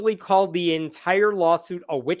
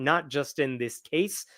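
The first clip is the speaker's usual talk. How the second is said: ordinary talk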